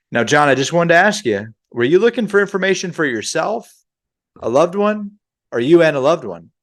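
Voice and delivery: high-pitched telesales questioning; sales agent. Shown as a demonstration. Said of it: "waiting to hear your voice say".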